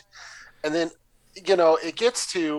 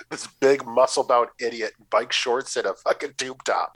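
normal talking voice

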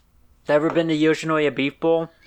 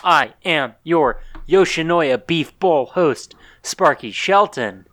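announcer voice; 3 semitones higher, 4 dB louder, slower than usual. Acted. Announcer-style podcast host intro.

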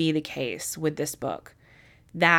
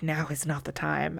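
soft refusal; 9 dB quieter than usual. Retold or imitated. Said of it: "it's like".

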